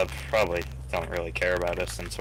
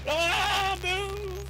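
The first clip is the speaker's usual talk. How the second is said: high panicked yell; shouting orders over battle. Real, not acted. Under fire, urging driver.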